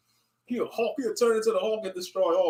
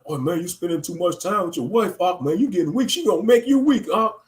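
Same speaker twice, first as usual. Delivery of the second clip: building from plain to a high, loud, fast whine; mocking jealous brother; 7 semitones higher, 4 dB louder than usual. Mocking a camp brother who blames the wife, building higher and louder.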